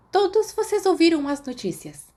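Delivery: hint of a tight, tired professor; tense, tired teacher. Professor telling students the bad news, tense and tired. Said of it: "sua voz mais fina do que o normal, tensa e cansada".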